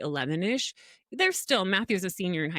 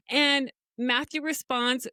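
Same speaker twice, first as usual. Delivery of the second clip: ordinary delivery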